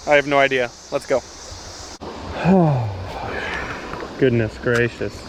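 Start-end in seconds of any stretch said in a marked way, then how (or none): 1.4-4.1 long low defeated sigh; heavy sigh. Real, not acted. Angler sighs after losing the fish, heavy sigh. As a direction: let it all out in one breath.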